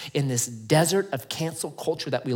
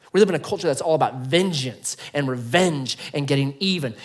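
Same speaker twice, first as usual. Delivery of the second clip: usual speaking voice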